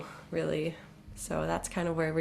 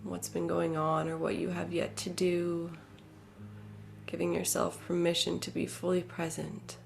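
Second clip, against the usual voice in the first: guided meditation; 8 dB quieter than usual. Real, not acted. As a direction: settle listeners inward with a quiet, unhurried delivery. Leads a meditation.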